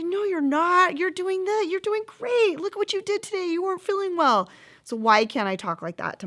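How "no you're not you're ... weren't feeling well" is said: put-on high, bright cheering as if to a little child; reassuring encouragement. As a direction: lift her up, tell her she's doing great. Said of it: "I would say".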